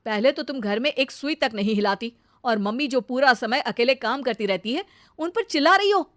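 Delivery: loud, cutting telling-off; husband's angry rebuke. Acted. Husband scolds wife for shouting at his mother, angry. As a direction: shut her up and take her to task.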